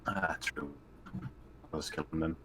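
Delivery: ordinary talk, nothing marked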